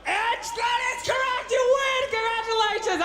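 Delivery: excited winner announcement. Real, not acted. Host announces the quiz winner.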